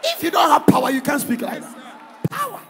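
loud shout of the lesson; preaching at full force. Acted. Preacher shouting the lesson at the congregation. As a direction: bellow it to the crowd.

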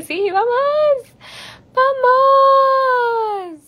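very high, drawn-out squeal; excited cheer. Real, not acted. Streamer accepting an invitation to Chile, squealing with excitement. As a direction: cheer it slowly, stretching the vowel high.

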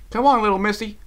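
high, loud urging; urgent urging. A narrator's reading. Applejack pulling her friend toward the bank, urging loudly. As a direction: coax loudly and firmly, pitched high.

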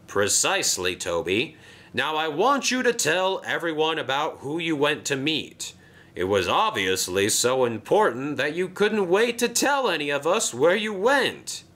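Sir Topham Hatt. Acted.

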